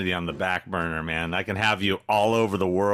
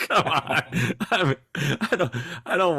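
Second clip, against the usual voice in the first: laughing; 9 semitones higher than usual. Real, not acted. Laughing complaint at the band.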